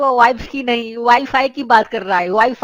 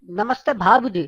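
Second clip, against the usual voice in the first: ordinary talk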